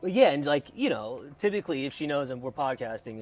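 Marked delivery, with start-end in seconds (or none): none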